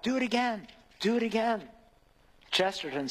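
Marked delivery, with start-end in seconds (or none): 0.0-1.7 put-on lifted child begging, higher and eager; child crying out. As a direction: beg like a giggling little kid. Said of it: "the child cries out".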